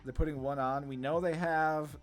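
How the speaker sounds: ordinary delivery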